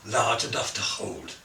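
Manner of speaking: ordinary delivery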